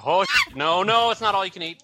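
snatch the offer back in a loud panic; panicked backtrack. Retold or imitated. Host backtracking loudly on the big eater. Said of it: "they're like".

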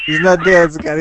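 loud, high, punched on dead; mock outrage. Cutting off his cohost to defend Mister Rogers, loud mock outrage. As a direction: cry it out in fake indignation.

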